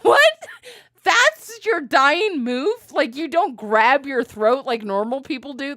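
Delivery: recreate your stunned reaction to her dramatic collapse; incredulous disbelief. Retold or imitated. Retelling own incredulous reaction.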